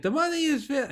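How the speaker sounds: a high, hammed-up stab at an Israeli accent; imitating an Israeli soldier with a self-admitted bad accent; mock Israeli accent. Podcast host quoting his Israeli guest, mock Israeli accent. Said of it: "I can't do an Israeli accent".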